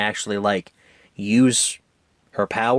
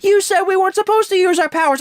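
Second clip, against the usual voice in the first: put-on fast, much higher protesting teen girl voice; imitating Violet; 5 dB louder, faster than usual. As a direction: blurt it back at mom in a high put-on voice. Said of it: "I can't do female voices".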